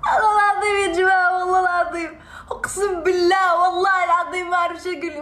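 high, tearful, fast pleading; real crying while talking. Real, not acted. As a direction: swear through sobs. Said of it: "والله ما قصدي أبكي".

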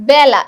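বেলা with open "æ", as bæla; open vowel. Shown as a demonstration. Teacher gives another example, open vowel. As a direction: stress বেলা with the vowel of "cat". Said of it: "এ এর মতো উচ্চারণ হচ্ছে".

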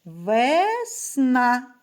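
весна stretched into two separate syllables, ве - сна. Shown as a demonstration. Teacher sounding out syllables for little pupils, drawn-out весна. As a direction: say весна slowly, split for counting. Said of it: "Слухайте".

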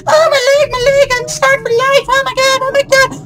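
hammed-up high wailing; mock panic in a squealing falsetto; hysterical woman. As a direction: imitate a woman shrieking over an injury. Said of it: "they're just like".

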